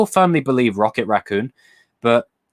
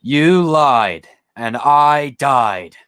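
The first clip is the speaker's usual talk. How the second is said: slow, drawn-out and a little louder; slow hammed-up quote; dramatic movie line; 4 semitones higher, 5 dB louder, much slower than usual. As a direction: drag out every word like a mock villain. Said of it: "remember the line".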